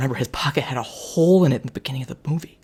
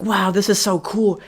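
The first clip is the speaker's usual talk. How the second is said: put-on kids in awe; 7 semitones higher than usual. Kids voiced in movie retelling.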